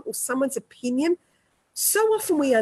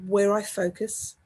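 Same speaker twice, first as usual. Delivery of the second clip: slow, measured affirmation voice; 3 semitones lower than usual. Acted. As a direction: lay each affirmation down slowly and calmly. Leads spoken affirmations.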